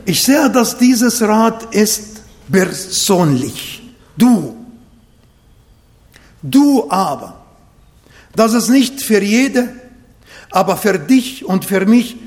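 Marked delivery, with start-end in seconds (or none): none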